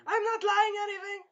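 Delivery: very high mock girlish protest; imitating a princess pleading innocence; squealed denial.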